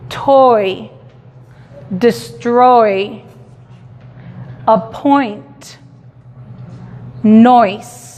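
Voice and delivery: "toy" "destroy" "appoint" "noise" con oi marcado; oi sound demo. Shown as a demonstration. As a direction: marca el diptongo oi despacio. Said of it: "esto tiene el sonido oi". (demostración del sonido oi)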